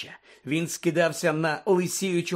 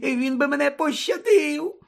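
very high, tearful squeal; cringing, wailing plea; desperate Pettigrew begging; 13 semitones higher, 5 dB louder, slower than usual. Acted. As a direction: beg for your life in a squeaky whimper. Pettigrew begging for his life.

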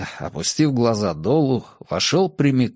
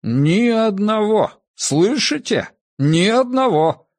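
emphatic triumph; 8 semitones higher, slower than usual. Acted. Grandfather insists triumphantly.

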